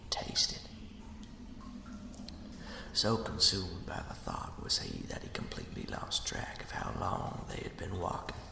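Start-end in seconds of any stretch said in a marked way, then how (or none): none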